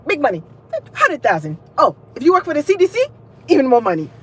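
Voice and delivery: high, punchy African professor parody; exaggerated African accent. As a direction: sell it in a put-on African accent. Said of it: "what kind of accent that was".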